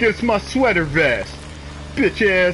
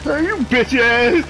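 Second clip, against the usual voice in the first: high, drawn-out mocking imitation; mock dramatic taunt; 7 semitones higher than usual. Player imitating the car's dramatic swerve, mock taunt. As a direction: ham up a dramatic brush-off. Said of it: "he all swerved for dramatic effect".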